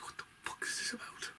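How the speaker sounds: whispered disbelief; baffled whisper. Retold or imitated. Reviewer recalling his confusion at the alien fight, whispered. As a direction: breathe it out in a stunned whisper. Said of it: "I'm just like".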